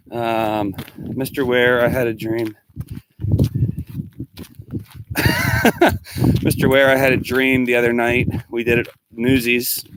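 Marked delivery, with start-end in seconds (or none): 3.2-6.4 laugh. Real, not acted. Laughing at the dream.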